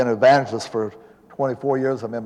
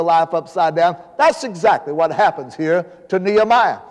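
higher-pitched, emphatic pulpit preaching; impassioned preaching; 5 semitones higher than usual. Real, not acted. Preacher tells congregation of a life-changing message, impassioned preaching. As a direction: drive the message home to the congregation.

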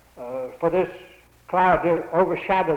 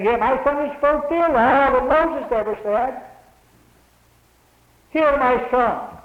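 voice from heaven; 7 semitones higher, 3 dB louder than usual. Acted. God's voice from cloud, quoted.

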